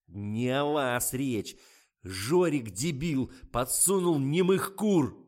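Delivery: loud, drawn-out furious rant; director losing it. A narrator's reading. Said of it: "пошёл в разнос режиссёр".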